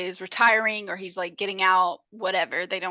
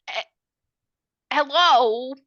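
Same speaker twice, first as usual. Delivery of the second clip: loud, high disbelieving hello; incredulous hello; 4 semitones higher, 7 dB louder, slower than usual. Real, not acted. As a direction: call out in shocked disbelief. Outraged at stepdaughter affair.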